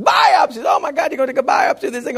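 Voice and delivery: high, alarmed outburst; hammed-up patient panicking at the news; mock panic. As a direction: blurt it in pretend horror. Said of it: "panic set all over the guy".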